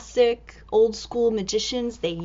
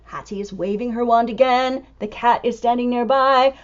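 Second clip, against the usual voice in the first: usual speaking voice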